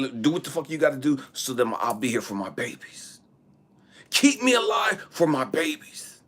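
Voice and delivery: urgent retold plea, starting high and loud; impassioned plea. Single father begging doctor to keep him alive, impassioned plea. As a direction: plead hard, surging again at the end. Father pleading to live for children.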